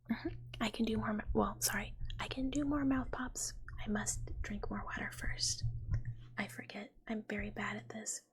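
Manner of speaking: normal talking voice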